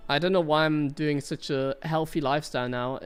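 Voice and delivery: normal talking voice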